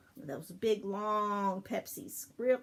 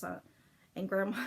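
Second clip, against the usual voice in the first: usual speaking voice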